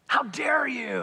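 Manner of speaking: mock outrage. Churchgoer rebuking someone who confessed, mock outrage.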